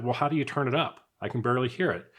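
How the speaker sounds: usual speaking voice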